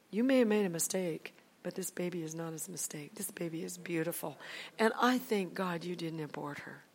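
quiet, tender retold comfort; gentle reassurance. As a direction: reassure her softly and sincerely. Reassuring an ashamed friend, retold.

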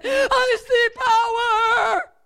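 high, long-drawn retold scream; shaking scream. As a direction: cry it out as if shaken to the core. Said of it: "I'm shaking like this".

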